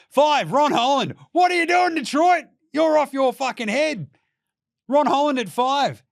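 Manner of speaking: loud, high disbelief at the draft pick; outraged. Real, not acted. Podcaster yelling at Detroit's draft pick, outraged loud.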